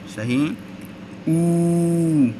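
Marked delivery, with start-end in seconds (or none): 1.3-2.3 ऊ held long and higher; long oo sound. Shown as a demonstration. Teacher demonstrating the long ऊ. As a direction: draw ऊ out, rounded lips. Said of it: "ऊपर उसके पेश".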